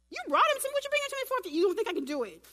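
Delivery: high, loud, rushed mock outburst; hammed-up exasperated yell; indignant Jesus. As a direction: fire it off in acted disbelief. Acting indignant Jesus to father.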